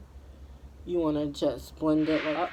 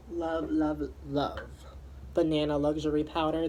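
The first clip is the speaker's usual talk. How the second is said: usual speaking voice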